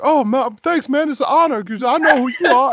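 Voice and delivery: high, loud, thrilled praise; retold excitement. [name] thanking the voice actor, high and thrilled. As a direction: gush with excitement.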